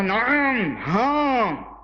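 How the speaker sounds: mild, drawn-out mock cry; imitated jinn scream. Preacher imitating the beaten man's jinn, mildly mock-screaming. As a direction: imitate a jinn crying out, held back. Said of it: "بڑی زور سے چیخا".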